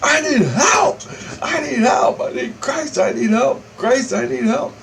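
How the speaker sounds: very high, strained reenacted shouting; yelling for help. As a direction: cry out desperately, as if mid-fight. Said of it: "I just start yelling out".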